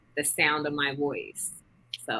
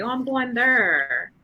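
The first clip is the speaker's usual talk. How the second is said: drawn-out, higher Saint Louis R's; imitated Saint Louis accent; 7 semitones higher, 4 dB louder, slower than usual. Speaker quotes Saint Louis locals, imitated rolled-R accent. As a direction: imitate Saint Louis speech, stretching out the final R. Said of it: "they roll their R's".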